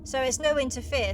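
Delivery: usual speaking voice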